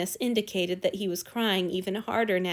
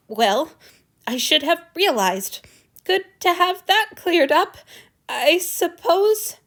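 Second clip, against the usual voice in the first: high, loud, holding back tears; voice cracking; 8 semitones higher, 7 dB louder than usual. A narrator's reading. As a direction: fight back a sob. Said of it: "His voice cracked, hitched over a sob".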